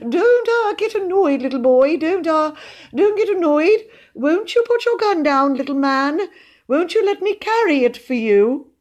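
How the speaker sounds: high, hesitant humouring tone; nervous high-pitched coaxing; anxiously soothing. A narrator's reading. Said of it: "he said soothingly".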